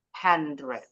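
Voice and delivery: HUNDRED as "han-dred", r before e; r dulu baru e. Shown as a demonstration. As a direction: say HUNDRED as it is spelt, ending "dred". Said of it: "R-nya dulu, baru E". (HUNDRED dibaca "han-dred")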